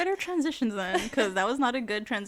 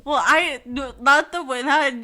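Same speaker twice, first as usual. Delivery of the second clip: mock scolding; 4 semitones higher, 9 dB louder than usual. Real, not acted. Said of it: "I'm, like, crying".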